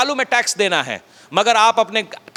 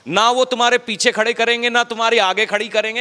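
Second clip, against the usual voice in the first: ordinary talk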